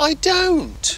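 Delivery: high-pitched, indignant protest; indignant denial. Acted. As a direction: protest as if wrongly accused, pitch up. Dad denies being impatient.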